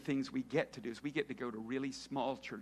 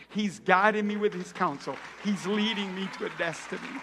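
rising fervor; 3 semitones higher, 7 dB louder than usual. Retold or imitated. Woman's testimony building up.

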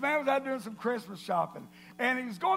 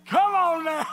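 loud, higher rallying call; real shout; 5 semitones higher, 8 dB louder than usual. Real, not acted. Preacher to his crowd after the punchline, shouting.

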